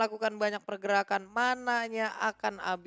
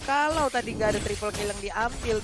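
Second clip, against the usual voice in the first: ordinary talk, nothing marked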